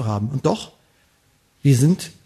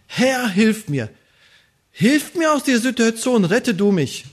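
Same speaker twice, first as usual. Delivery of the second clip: pleading cry; 6 semitones higher than usual. Acted. Psalmist pleading to God.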